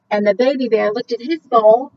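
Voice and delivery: plain reading voice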